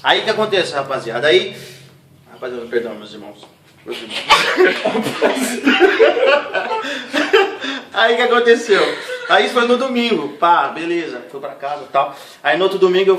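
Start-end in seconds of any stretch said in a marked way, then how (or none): 4.2-7.9 long, high, loud burst of laughter. Real, not acted. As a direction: crack up again. Laughing at his own story.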